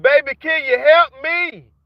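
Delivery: mimicking people calling for help, hammed-up; mocking desperate plea. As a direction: whine it like flood victims begging. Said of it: "They hollering".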